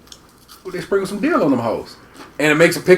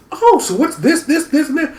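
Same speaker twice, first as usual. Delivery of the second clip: squeaky high voice of an eager tourist lady, laughing; imitating a curious old lady, high and laughing; high mock lady voice; 11 semitones higher than usual. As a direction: pitch way up and giggle through it.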